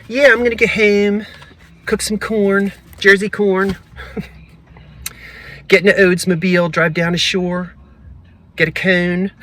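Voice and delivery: a playful send-up of a South Jersey local; mock South Jersey accent. Vlogger making fun of South Jersey locals, mock South Jersey accent. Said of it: "I'm making fun of South Jersey accents".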